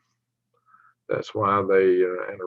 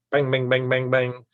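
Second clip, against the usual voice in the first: rapid-fire twangy Southern repetitions; Southern twang; 3 semitones higher than usual. Shown as a demonstration. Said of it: "got the twang, but it comes at you quick".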